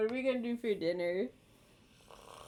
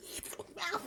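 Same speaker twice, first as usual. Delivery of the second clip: whispered stop; 10 semitones higher, 11 dB quieter than usual. Real, not acted. Pinned, whispered protest.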